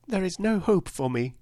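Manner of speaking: put-on high, mournful little-girl voice; sad. Dorothy tells her friends there's no hope, sadly. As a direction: lament like a child without hope. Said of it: "she said sadly".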